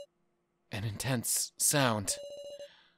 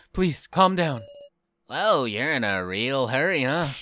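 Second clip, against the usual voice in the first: ordinary delivery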